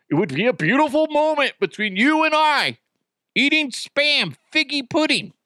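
high, put-on earnest plea; mock indignant voice. Host defending a shared can with listeners, mock indignant voice. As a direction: push it up high, hammed-up and defensive.

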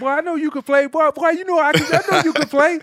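high Southern voice, laughing a little; grudging praise in a Southern twang. Retold or imitated. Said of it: "Renard had this Southern twang".

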